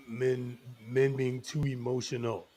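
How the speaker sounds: ordinary talk, nothing marked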